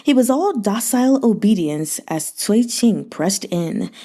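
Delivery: plain reading voice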